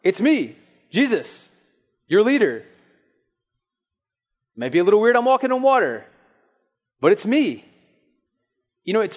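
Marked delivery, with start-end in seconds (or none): 0.0-7.6 high, loud, breezy yo-calm-down Jesus; hammed-up casual Jesus shouting over the wind. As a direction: call it out across the storm like a buddy. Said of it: "he's like".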